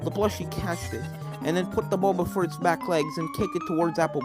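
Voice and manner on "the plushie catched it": ordinary delivery, nothing marked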